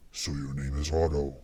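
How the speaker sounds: low whispered demon voice; demonic whisper. A narrator's reading. Damien secretly addresses the dead intruder's soul in a low demonic whisper. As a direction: murmur it low and pleased. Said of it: "he whispered".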